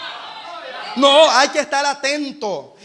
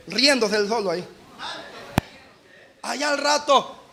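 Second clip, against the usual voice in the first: normal talking voice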